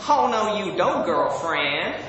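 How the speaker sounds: put-on drawn-out sassy American "girlfrand"; American accent. Shown as a demonstration. Brit trying American phrases, sassy American accent. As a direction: scold with sassy American attitude. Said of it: "my best American accent".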